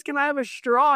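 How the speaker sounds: high, light voice asking for a straw; Lorraine asking politely. Retold or imitated. To the tour guide, sweetly asking for a straw in a high voice. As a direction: pitch it up like a polite request. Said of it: "Lorraine goes".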